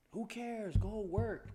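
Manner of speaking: loud, high mock yell of a tough dad. Dad pushing his son to train, loud mock yell. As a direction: bark it like an impatient father. Dad's tough-love line voiced.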